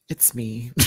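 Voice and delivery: normal talking voice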